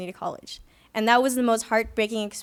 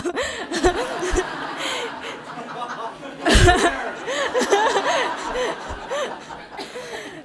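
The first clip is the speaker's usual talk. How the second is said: a long, high, bright burst of real laughter; 10 semitones higher than usual. Real, not acted. Laughing at herself about ending up at Berkeley.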